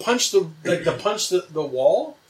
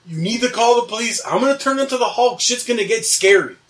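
higher, insistent warning; retold urgent warning; 6 semitones higher than usual. Dreamer warning roommates he will turn Hulk, urgent. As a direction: press them to act, voice raised in pitch. Warns roommates the girl is coming.

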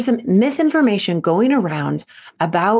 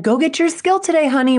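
higher, sugary mom send-off; sweet mom; 8 semitones higher than usual. Retold or imitated. A mom cheerfully sending her kid into the gym, voiced higher. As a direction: chirp it like a mom at the car door. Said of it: "Their mom just said".

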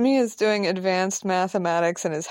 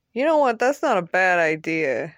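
ordinary talk, nothing marked

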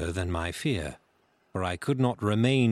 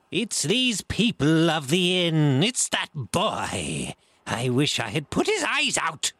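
furious cry; 8 semitones higher, 4 dB louder than usual. A narrator's reading. Blind man cursing the boy, angry cry. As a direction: draw out the accusation in rage. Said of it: "cried the blind man".

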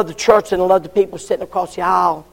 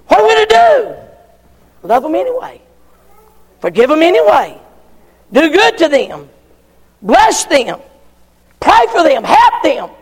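full-force preaching; 8 semitones higher, 4 dB louder than usual. Acted. Preacher urging the church to love anyway. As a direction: preach it out to the congregation.

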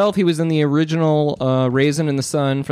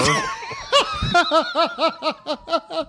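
long, high shrieking laugh; real laughter. Real, not acted. Friend whose prank worked, long high laughter. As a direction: crack up helplessly, high-pitched. Prompted roar word lands.

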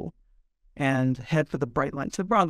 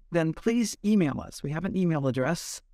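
ordinary delivery

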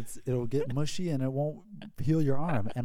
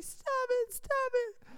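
much higher, whimpering stop it; panicked begging; 3 dB quieter, much slower than usual. Retold or imitated. Boy begging his friend driving the jet ski, high panicked whimper. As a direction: plead like a scared thirteen-year-old.

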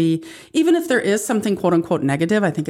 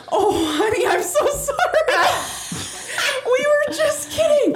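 much higher, laughing through the apology; slower than usual. Retold or imitated. Mom retelling apology to daughter, laughing. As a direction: try to apologize but can't stop laughing.

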